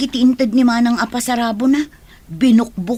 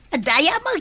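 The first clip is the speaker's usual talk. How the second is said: ordinary delivery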